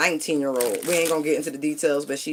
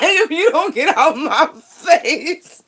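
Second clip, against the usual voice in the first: slow, high, loud laughing warning; dragged out through a laugh; 13 semitones higher, 6 dB louder, much slower than usual. Real, not acted. Laughing while telling the young man to get out her face.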